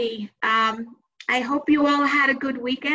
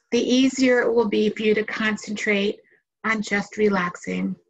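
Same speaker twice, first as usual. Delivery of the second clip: guided meditation. Acted. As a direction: guide listeners into relaxation, unhurried phrase by phrase.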